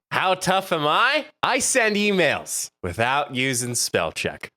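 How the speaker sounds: slow, drawn-out tough-guy boast; hammed-up bragging at the door; mock tough guy. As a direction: boast slowly, pitched high at first. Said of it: "I will do with my first one".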